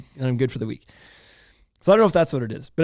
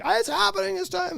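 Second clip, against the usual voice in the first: very high panicky child imitation; emergency kid voice. Child being rushed downstairs by parent, high urgent kid voice. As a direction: squeal it like an urgent toddler. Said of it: "He's going emergency".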